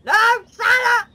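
high loud slowed-down shock; shocked shout. Real, not acted. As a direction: scream it in disbelief. Santa crashes in game.

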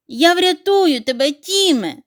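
high, loud promise to save him; dog shouting to the trapped duckling. A narrator's reading. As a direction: call out as you run to help. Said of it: "закричав Чорниш".

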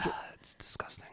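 soft, breathy whisper of disgust. Real, not acted. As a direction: whisper it, grossed out. Disgusted remark about squid.

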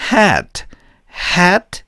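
"hat" pitched high, weight on the back of the vowel; stressed vowel glide. Shown as a demonstration. Teacher repeats the stress for learners, high emphatic "hat". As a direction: hit the later half of the ae in "hat". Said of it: "뒤에 강세가 있어요".

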